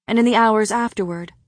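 ordinary delivery, nothing marked

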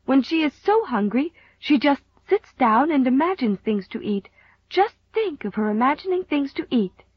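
put-on high, impassioned young daughter; earnest pleading. A narrator's reading. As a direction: plead for sympathy for your hungry friend. Daughter pleads friend's case to mother.

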